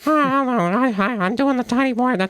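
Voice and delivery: put-on high, pinched little kid voice; tiny boy voice. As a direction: squeak it out like a small boy. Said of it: "the kid voice that he does".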